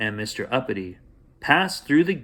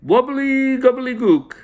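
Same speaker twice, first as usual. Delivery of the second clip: very high, louder goblin voice; goblin spell said backwards; 13 semitones higher, 7 dB louder than usual. Acted. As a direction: squeal the magic words up high. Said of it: "said backwards".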